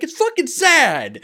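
high, loud, drawn-out comic wail; mock crying. Husband caught crying by his wife, mock tearful wail. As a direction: wail it through tears, hammed up. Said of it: "Are, are you crying?".

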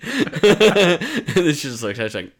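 normal talking voice

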